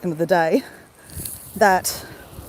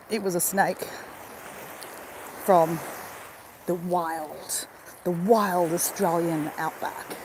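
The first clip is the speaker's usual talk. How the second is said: mock ominous; 5 semitones lower, 10 dB quieter, slower than usual. Spooky telling of snake's origin.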